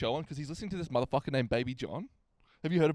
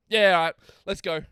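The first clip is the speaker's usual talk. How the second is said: loud, higher, sudden agreement; imitating eager child; 5 semitones higher, 10 dB louder than usual. Child agreeing to go to bed with his teddy, mock eagerness. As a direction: agree at once like a keen kid.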